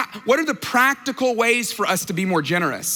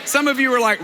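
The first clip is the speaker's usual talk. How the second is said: ordinary talk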